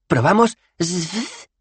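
very high, slow suggestion; Twoflower's voice. Acted. Dos Flores timidly suggesting zf, slow and high. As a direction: offer it timidly.

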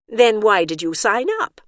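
ordinary delivery, nothing marked

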